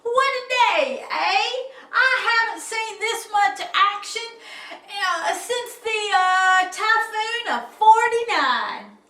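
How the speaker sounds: high, loud hearty sailor; jolly sea captain. Acted. Captain slapping friend's back, loud and hearty. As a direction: crow like an old salt.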